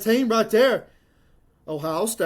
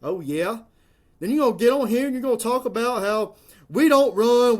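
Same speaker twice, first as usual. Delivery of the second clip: ordinary talk, nothing marked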